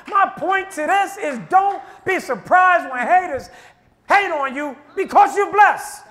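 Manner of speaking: loud preaching; preaching at full force. Acted. Preacher warning the congregation about haters, shouting. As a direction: drive the point home.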